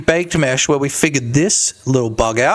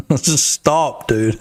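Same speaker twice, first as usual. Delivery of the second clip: ordinary talk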